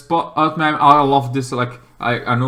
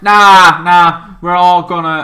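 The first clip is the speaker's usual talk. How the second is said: louder, firm brush-off; defiant refusal; 4 semitones higher, 9 dB louder, slower than usual. Retold or imitated. Squad refusing Waller's order, loud defiant nah. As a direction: wave her off, loud and sure.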